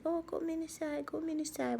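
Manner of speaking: a hint of a gentle nurse coaxing; imitating soft-spoken nurse. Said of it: "and they talk softly".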